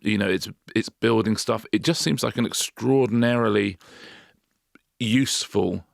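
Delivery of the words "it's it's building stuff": ordinary delivery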